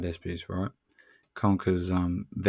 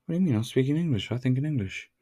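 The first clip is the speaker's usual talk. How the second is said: taken aback; 6 semitones higher, much faster than usual. Retold or imitated. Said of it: "People are always taken aback".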